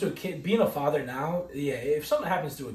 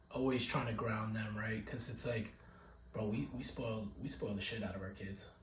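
quiet; 5 semitones lower, 14 dB quieter than usual. Real, not acted. Confiding aside about spoiling kids.

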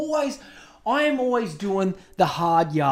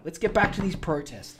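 ordinary talk, nothing marked

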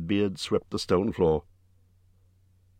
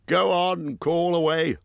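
Bjorn's voice; 11 semitones higher, slower than usual. Acted. Bjorn speaks to Gandalf.

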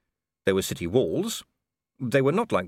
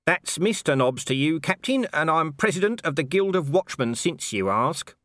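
smug Nobby; 8 semitones higher than usual. Acted.

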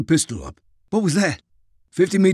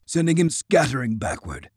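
ordinary delivery, nothing marked

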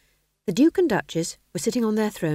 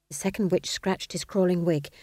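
plain reading voice

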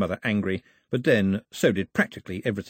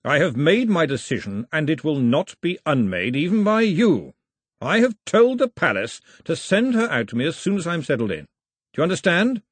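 louder, higher final word; firm decision; 6 semitones higher, 4 dB louder than usual. Acted. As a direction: set it down like law. Father asserting decision.

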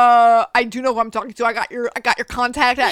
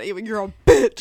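all caps; 4 semitones lower, 6 dB louder than usual. Retold or imitated. Repeating the all-caps text to her friend, punched loud. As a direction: read it louder, like a text in capitals. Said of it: "In all caps".

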